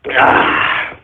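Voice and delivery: breathy "ah" after a swig; post-drink gasp of satisfaction; satisfied exhale. Real, not acted. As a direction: let out a voiceless refreshed sigh. Takes a drink.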